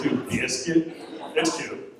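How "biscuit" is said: light imitation of a Southern boyfriend; boyfriend's Southern accent. Quoting the boyfriend's pet name, lightly Southern accent. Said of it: "That's how he says it, too".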